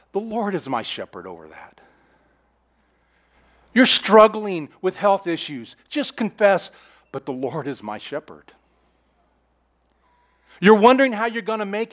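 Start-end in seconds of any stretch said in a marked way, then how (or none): 0.1-1.8 low and soft, drawn inward; soft, low murmured declaration; hushed confession. Shown as a demonstration. Quiet confession after struggles. 7.1-8.5 low and soft, drawn inward; soft, low murmured declaration; hushed confession. Shown as a demonstration. Quiet confession after struggles.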